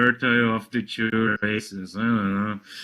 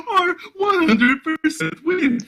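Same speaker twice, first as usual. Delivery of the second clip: high, slow, tearful-laughing read; 13 semitones higher, slower than usual. Real, not acted. Reading absurd fertility rule, laughing till tearful. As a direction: squeeze words out through laughter.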